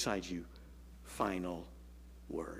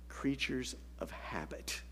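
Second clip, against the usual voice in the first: whispered slowly, softer; 3 semitones lower, 8 dB quieter, slower than usual. Real, not acted. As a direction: let it sink in, hushed. Sermon emphasis.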